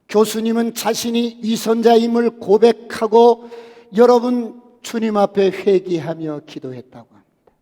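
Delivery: full-force pulpit preaching; fiery preaching. Acted. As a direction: preach it out to the congregation.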